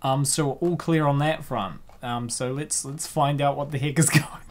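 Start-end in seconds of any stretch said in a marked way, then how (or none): none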